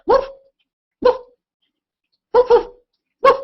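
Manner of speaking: high, loud imitated woof; dog barking. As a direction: bark like a little dog. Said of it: "We're gonna be like".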